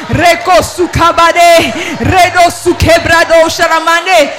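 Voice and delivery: loud, slow ecstatic tongues; praying in tongues. Real, not acted. Pastor praying in tongues over the congregation, loud and fervent. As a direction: pour out tongues at full force.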